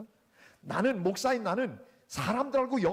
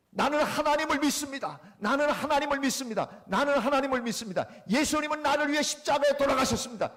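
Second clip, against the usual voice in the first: loud, high proclamation; shouted declaration of faith; 6 semitones higher, 6 dB louder than usual. Retold or imitated. Friend shouting his creed alone in the church, loudly. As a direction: call it out loud in an empty church. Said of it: "냅다 소리를 질렀어요".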